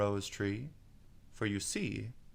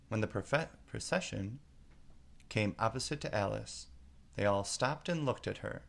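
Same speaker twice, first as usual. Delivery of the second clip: ordinary delivery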